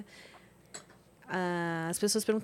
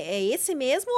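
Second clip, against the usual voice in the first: usual speaking voice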